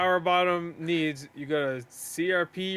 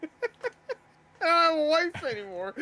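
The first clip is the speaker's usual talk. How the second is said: high mock whine; mock sob story; 12 semitones higher than usual. Mocking streamer begging online.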